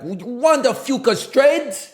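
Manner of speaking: loud, high, drawn-out mock Russian accent; John Malkovich's bad Russian accent. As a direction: put on a hammy Russian accent, very slow and high. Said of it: "John Malkovich famously has a bad Russian accent".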